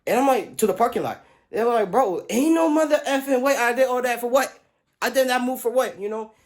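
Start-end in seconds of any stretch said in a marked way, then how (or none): 1.9-6.3 high, loud, exasperated; mock outraged friends; imitated complaint. Friends complaining about lost ball.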